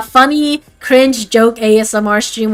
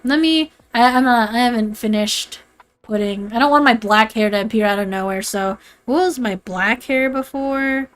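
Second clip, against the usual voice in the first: usual speaking voice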